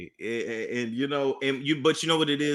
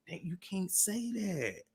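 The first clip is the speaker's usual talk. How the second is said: much softer, slow half-whispered telling-off; hushed mock scold; 4 semitones higher, 15 dB quieter, slower than usual. Son quietly scolding his mom for a rude remark. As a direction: scold her under your breath.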